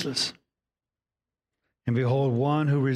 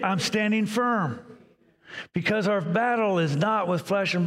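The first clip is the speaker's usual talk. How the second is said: preaching at full force; 8 semitones higher than usual. Acted. Preacher defying Satan before the congregation, in a high, driving chant. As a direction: hammer the refrain home against Satan.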